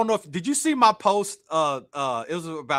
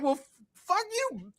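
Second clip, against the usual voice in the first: laughing retort; 12 semitones higher, 7 dB quieter, slower than usual. Retold or imitated. Retold reply, laughing.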